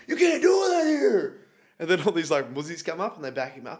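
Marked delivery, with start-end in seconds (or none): 0.1-1.4 high, loud, slow hollered protest; imitating outraged Southern boys shouting; mock Southern yell.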